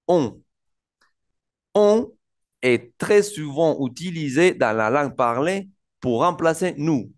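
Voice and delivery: "On" destacado, agudo y fuerte; pronunciación modelo de "On". Shown as a demonstration. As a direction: presenta la palabra "On" con énfasis. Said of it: "vamos a introducir ahora al on". (el profesor introduce "on" y lo pronuncia marcado)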